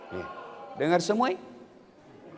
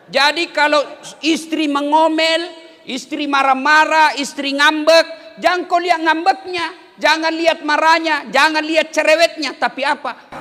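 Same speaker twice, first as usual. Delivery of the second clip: high, loud, driving sermon build; impassioned preaching; 9 semitones higher, 7 dB louder than usual. Acted. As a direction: hammer the list home to the congregation. Preaching at full force. (khotbah berapi-api, lantang dan tinggi)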